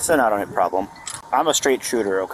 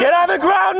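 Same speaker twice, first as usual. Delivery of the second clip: screaming orders at full force; shouted surrender demands; 3 dB louder than usual. Real, not acted. Police arrest, taser threatened.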